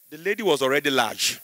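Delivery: usual speaking voice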